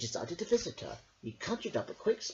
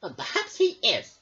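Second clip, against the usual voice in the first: higher, louder retort; huffy king; 7 semitones higher, 8 dB louder than usual. A narrator's reading. King retorting to Merrick, high and huffy. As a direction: snap back like a sulky child. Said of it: "said the king".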